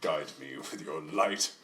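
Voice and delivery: low, slow, soft invocation; solemn prayer. Acted. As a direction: pray quietly to a deity for guidance. Character prays to deity for guidance.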